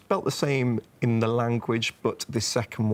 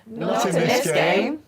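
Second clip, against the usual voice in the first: high, loud shout of the catchphrase; shouted on request; 8 semitones higher, 4 dB louder than usual. Pretend. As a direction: belt it out like a game-show crowd. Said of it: "I want you all to shout".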